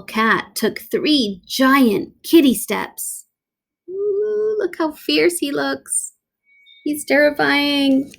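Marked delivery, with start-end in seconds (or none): 3.9-8.1 mock awe. Real, not acted. Reader mock-awed aside to child.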